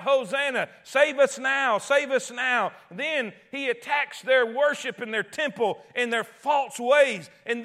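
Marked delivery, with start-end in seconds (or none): 0.0-2.7 raised, louder crowd plea; acted crowd cry, higher and loud. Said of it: "and crying out".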